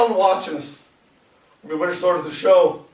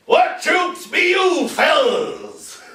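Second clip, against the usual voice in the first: put-on old soldier calling out; 10 semitones higher, much slower than usual. Old guy questioning the new troops, high hollered call. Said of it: "there's this old guy".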